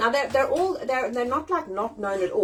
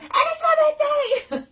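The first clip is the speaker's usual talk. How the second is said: very high, loud birthday holler; gleeful squealed shout from the crowd; heckling shout; 6 dB louder than usual. Retold or imitated. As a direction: yell it out to interrupt the show. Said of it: "I was heckling".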